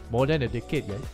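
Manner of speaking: usual speaking voice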